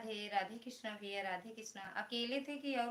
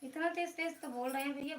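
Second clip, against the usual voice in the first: raised, higher voice in a fast rush; loud protest; 5 semitones higher, 4 dB louder, faster than usual. Real, not acted. Streamer telling a viewer passers-by can hear, loud protest. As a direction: protest quickly, voice pushed up. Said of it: "कितना चिल्ला चिल्ला के बोल रहे हैं".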